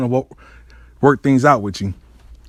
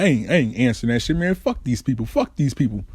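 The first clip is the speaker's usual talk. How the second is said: muttered refusal; 6 semitones lower, 6 dB quieter, faster than usual. Retold or imitated. Refusing the phone, muttering to himself.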